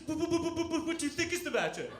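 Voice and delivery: put-on high, blubbering child stammer; mock weeping. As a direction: stutter through pretend sobs. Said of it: "I'm weeping".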